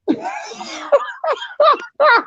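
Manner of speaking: high, helpless cackling laugh; hard laughter. Real, not acted. Storyteller cracking up at his own punchline, laughing hard. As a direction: crack up completely.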